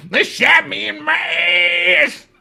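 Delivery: high, slow, loud imitated wail; mock wailing complaint. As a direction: drag the complaint out in a high yell. Mimics inmate's wailing complaint.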